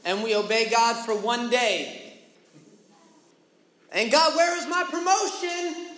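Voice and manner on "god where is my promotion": high demanding whine to God; demanding shout. Retold or imitated. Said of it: "we get entitled".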